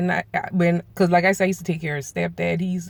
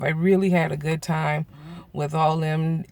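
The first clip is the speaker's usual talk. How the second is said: ordinary delivery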